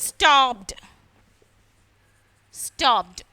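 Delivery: stopped and സ്റ്റോപ്ഡ് each as one syllable, "stopt". Shown as a demonstration. Teacher gives students an example, stopped with ED sounded as T. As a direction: end stopped on a crisp T, falling. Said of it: "ടി പോലെയാണ്".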